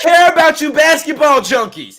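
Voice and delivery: very loud, high, impassioned yell; real full-volume outburst; shouting. Real, not acted. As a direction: shout the point over your cohost. Heated shouted point to cohost.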